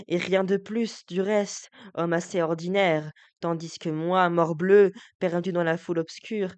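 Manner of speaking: Figaro trahi, amer et emporté; déclame comme un homme blessé; monologue déclamé. Acted. (Figaro déclame, amer et emporté)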